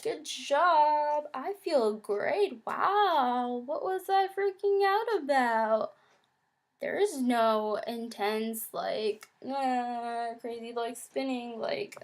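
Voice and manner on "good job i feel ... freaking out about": bright, high, cheery self-congratulation; relieved self-praise. Retold or imitated. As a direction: cheer yourself on, pitched well up. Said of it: "and I was like".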